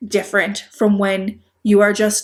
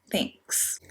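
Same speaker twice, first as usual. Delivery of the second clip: cold thanks; 14 dB quieter than usual. Retold or imitated. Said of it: "gave me a death stare and said".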